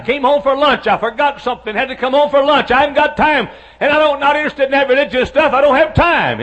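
loud, high, fast refusal; busy factory man snapping at the preacher; irritated brush-off. Retold or imitated. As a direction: shove the preacher off the doorstep.

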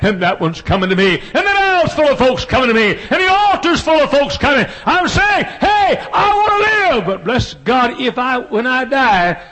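high, loud preaching shout; fervent shouted preaching; preaching at full force. Acted. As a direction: preach at the top of your voice. Preaching peak.

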